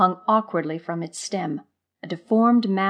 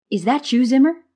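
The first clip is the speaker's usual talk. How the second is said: higher, louder question to a friend; curious call; 6 semitones higher, 6 dB louder than usual. A narrator's reading. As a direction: call out to check who's there. Marva calls to unseen friend.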